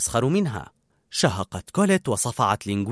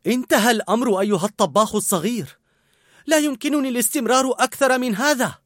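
ordinary delivery

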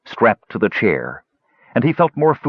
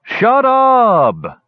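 much higher, loud gully dwarf scream; 5 dB louder than usual. Acted. Numskull shrieking at the cheering gully dwarves, screamed. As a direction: scream it to silence the crowd. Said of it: "he screamed".

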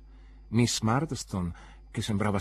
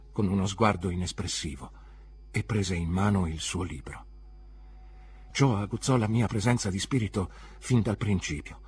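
plain reading voice

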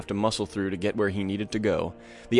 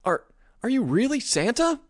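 put-on high, hesitant child voice; a wary little boy asking; a boy stammering at the door; curious boy; 14 semitones higher than usual. A narrator's reading. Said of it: "curiosity overcoming the ridiculous horror".

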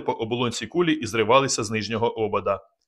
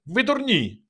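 much higher yelled insult; high shout; 12 semitones higher, slower than usual. A narrator's reading. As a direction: fling it at them in alarm. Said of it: "закричав Казик".

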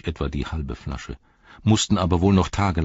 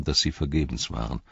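ordinary delivery

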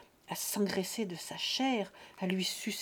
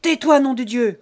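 loud, high roar; furious shout; 5 semitones higher, 15 dB louder than usual. Acted. Father silencing his sneering son, furious bellow. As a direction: cut him off with a bellow.

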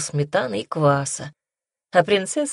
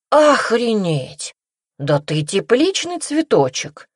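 slow stunned exclamation; astonished; 5 semitones higher, slower than usual. Acted. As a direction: drag out the amazement. Rik stunned by her confession.

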